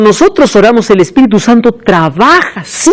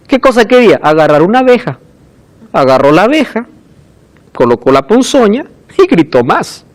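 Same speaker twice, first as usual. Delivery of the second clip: usual speaking voice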